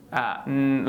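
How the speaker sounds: ordinary delivery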